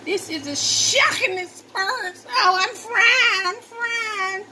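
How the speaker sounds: high, loud, drawn-out mock wail; hammed-up hollering. Old trustee strapped in the electric chair, hammed-up hollering. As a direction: imitate a man wailing as he fries. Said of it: "I like to holler".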